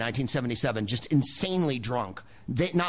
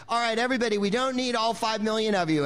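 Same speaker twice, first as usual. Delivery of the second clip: calling out; 8 semitones higher than usual. Retold or imitated. Overwhelmed PA shouts at crowd of extras, raised voice. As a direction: call over a mob of extras. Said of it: "had to go like".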